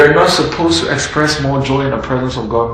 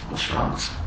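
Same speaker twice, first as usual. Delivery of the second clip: whispered; 12 dB quieter than usual. Acted. Father asks gently.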